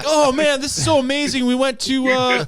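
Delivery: mock wide-eyed excitement over Toronto food; gushing tourist imitation. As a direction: play a giddy host raving about the trip. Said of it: "they're like".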